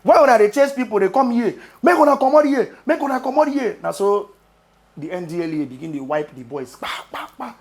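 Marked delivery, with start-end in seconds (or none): 0.0-3.8 louder, higher mock jetty guy in Nigerian Pidgin accent; Pidgin-accented mock shouting at the officers. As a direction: bark it like an angry jetty boy, Pidgin accent.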